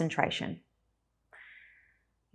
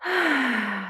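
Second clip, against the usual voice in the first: long falling sigh out through the mouth; demonstrated relaxing exhale; 7 semitones higher than usual. Shown as a demonstration. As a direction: let the breath go in an audible sigh. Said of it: "Sighing it out through the mouth".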